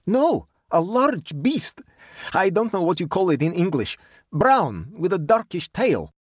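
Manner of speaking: put-on high-pitched boyish huntsman, proud of his catch; eager young Russian. Vladimir describes his kill to Nora, proud and eager. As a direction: play the radiant Russian youth.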